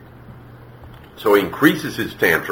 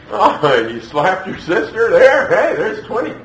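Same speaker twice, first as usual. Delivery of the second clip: high-pitched mock indulgence; over-the-top generous parent voice, pitched way up; mock rewarding parent; 10 semitones higher than usual. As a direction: play a parent gleefully paying off bad behavior.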